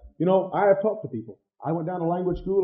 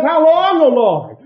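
psalm cry; 10 semitones higher, 9 dB louder, slower than usual. Retold or imitated. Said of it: "Like the psalm says".

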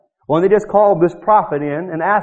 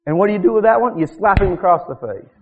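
ordinary talk, nothing marked

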